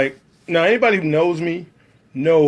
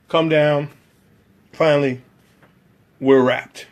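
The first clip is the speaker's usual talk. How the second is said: ordinary talk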